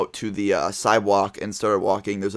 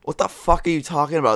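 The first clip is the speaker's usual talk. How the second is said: higher-pitched, bewildered objection; retold indignant protest; 6 semitones higher than usual. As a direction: act out baffled outrage.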